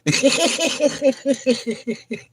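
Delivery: long, high run of cackling; cackle. Real, not acted. Streamer tickled by own soundbite, cackling. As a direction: keep cackling, unable to stop. Keeps laughing at own rant.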